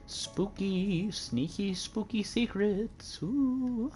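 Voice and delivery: ordinary talk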